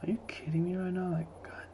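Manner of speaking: hushed unvoiced whisper. Real, not acted. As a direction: breathe it out in a whisper. Creeped out in red forest.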